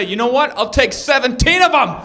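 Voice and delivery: high, loud declaration; mock big-spender shout; bold announcement. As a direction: call out the order with gusto. Acts out bold shelter order.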